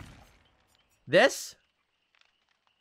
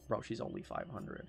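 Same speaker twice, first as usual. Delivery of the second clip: breathy whispered aside; 6 semitones lower, 15 dB quieter than usual. Real, not acted. Whispering to chat about the price. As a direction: whisper it to your bro.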